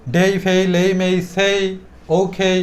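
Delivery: ডেই to ওখেই as a chain of long glides; drawn-out vowel. Shown as a demonstration. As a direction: stretch the "ei" in ডেই, ফেই, লেই, মেই, সেই. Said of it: "একটু টেনে টেনে বলবো". (ডেই, ফেই, লেই, মেই টেনে টেনে বলা)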